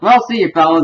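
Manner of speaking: high, cheery Butters goodbye. Retold or imitated. Butters leaves the boys in the bathroom, high and cheery. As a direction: chirp a farewell as Butters. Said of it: "doing his usual Butters thing".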